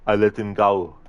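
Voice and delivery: action-hero movie impression, forceful; Arnie. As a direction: play the menacing action hero interrogating his captive. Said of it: "an Arnie movie scene impression".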